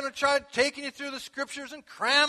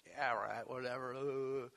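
low, slow, sceptical brush-off; mock dismissive; 10 semitones lower, 11 dB quieter, slower than usual. A friend brushing off church, lightly mocked. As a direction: roll your eyes in the voice. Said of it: "and they go".

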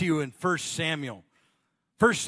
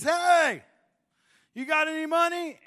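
high, loud call of a stranger; homeless man asking; 12 semitones higher, 6 dB louder than usual. Retold or imitated. Homeless man calls to the student for money, a loud high call. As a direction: call out loudly asking for cash. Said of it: "And he says".

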